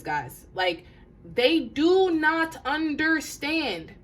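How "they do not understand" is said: slow, loud, higher, weight on every word of "they do not understand"; emphatic insistence. Real, not acted. Drives the point home to listeners.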